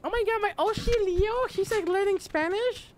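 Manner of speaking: very high, squealed mock fan voice; excited fan. Player imitating excited Spanish fans, high squeal. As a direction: squeal like a thrilled fan, far above your pitch. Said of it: "gonna be so excited".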